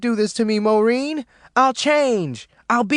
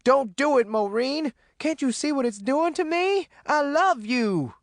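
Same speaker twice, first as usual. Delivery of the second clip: high-pitched wailing plea; desperate pleading; 12 semitones higher than usual. A narrator's reading. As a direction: beg her to stay, drawing out the end. Ruby desperately begs fleeing Maureen.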